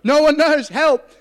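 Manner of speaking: high, loud comic wail; mock cry for help. Trapped teen begging the manager for rescue, mock desperate shout.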